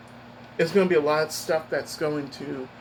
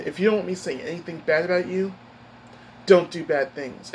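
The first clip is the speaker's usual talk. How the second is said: normal talking voice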